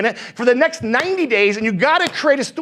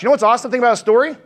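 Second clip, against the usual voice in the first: ordinary talk, nothing marked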